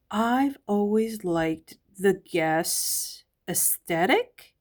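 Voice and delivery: ordinary talk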